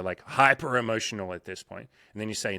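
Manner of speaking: ordinary talk, nothing marked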